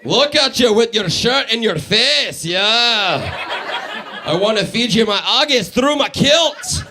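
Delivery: hammed-up slow Scottish brogue; mock Scottish pickup voice; Scottish accent. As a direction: do a drawn-out, boisterous Scottish dude. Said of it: "How does a Scottish dude walk into a bar".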